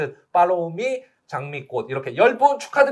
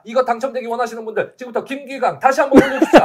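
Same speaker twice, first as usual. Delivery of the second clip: loud, high hype-up call; rally the viewers loudly; hyped cheering; 8 semitones higher, 8 dB louder than usual. Real, not acted. Hyping up viewers for prize draw. (신나게 외치는 응원)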